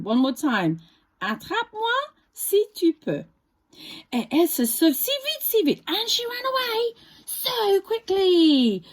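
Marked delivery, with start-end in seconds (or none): 1.2-3.2 high singsong taunt; playful runaway taunt; galette taunt. Acted. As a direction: tease the chaser once more.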